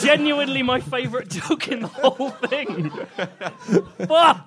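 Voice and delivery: high, loud, aggrieved complaint; comic dismay. Real, not acted. Comedian whose favourite joke got stolen, loudly aggrieved. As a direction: wail it in exasperation. Upset his joke was used.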